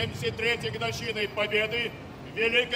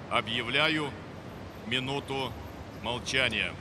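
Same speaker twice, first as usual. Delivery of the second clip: much lower, grave and measured; solemn announcement; 8 semitones lower, 4 dB quieter than usual. Real, not acted. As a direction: drop low and solemn for the silence. Official announces minute of silence.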